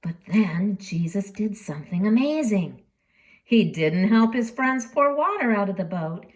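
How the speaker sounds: ordinary delivery, nothing marked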